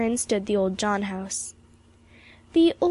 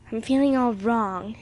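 usual speaking voice

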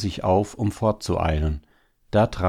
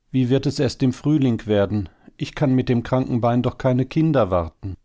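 higher, slightly louder plaintive worry; mild lament; 7 semitones higher, 3 dB louder than usual. A narrator's reading. Hare wife worrying about spring and her bad leg, mildly lamenting. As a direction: fret aloud in a raised pitch. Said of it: "klagte sie".

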